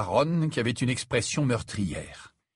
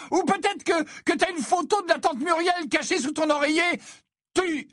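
very high mocking tirade; high-pitched scornful yelling; jeering shriek. A narrator's reading. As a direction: taunt your brother mercilessly. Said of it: "Ginny hurla d'un rire moqueur".

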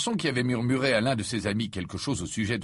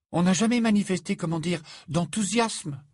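flustered protest; 9 semitones higher than usual. A narrator's reading. Hermione protesting, alarmed.

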